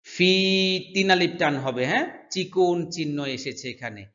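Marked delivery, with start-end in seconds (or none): none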